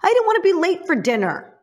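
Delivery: sneering imitation of Sally; mocking Sally. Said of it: "She's like".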